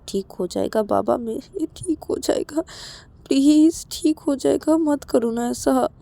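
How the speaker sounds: slow, soft coaxing; drawn-out, tender begging; pleading reassurance. Acted. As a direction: plead gently, stretching the words. Acted pleading, comforting upset friend.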